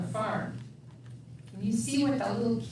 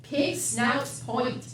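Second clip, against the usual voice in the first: ordinary delivery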